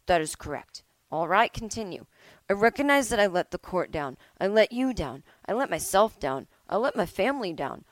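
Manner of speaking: normal talking voice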